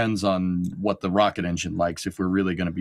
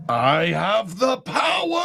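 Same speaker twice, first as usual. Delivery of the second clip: high, slow mock shout of triumph; 10 semitones higher, slower than usual. Cohost echoing a chat message with a hammed-up shout. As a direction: bellow it like a cartoon hero.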